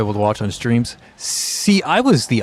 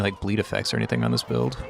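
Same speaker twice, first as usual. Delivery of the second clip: ordinary talk, nothing marked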